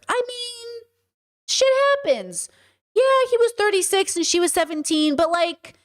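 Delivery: high-pitched mock shrugging it off; dismissive bystanders. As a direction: excuse it away in a high, noncommittal voice. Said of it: "people who are like".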